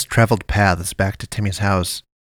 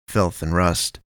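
usual speaking voice

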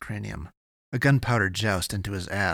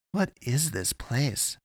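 wondering; 5 semitones higher, slower than usual. A narrator's reading. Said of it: "he wondered".

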